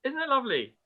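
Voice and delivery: high, sugary mock awe; mock sweet. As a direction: coo it sweetly. Echoes mock sweet reaction.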